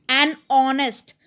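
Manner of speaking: an honest said as ɔnest, the h silent, slowly. Shown as a demonstration. Teacher showing class that honest begins with an o sound. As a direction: say honest with no h, starting on the o. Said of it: "इसको ऑनेस्ट बोलेंगे".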